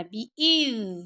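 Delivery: slow بی اذ with a soft held ذ; soft zal. Shown as a demonstration. Teacher showing students the letter, بی اذ with soft zal. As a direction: let the ذ of بی اذ hum gently. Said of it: "زال کو نرمی سے".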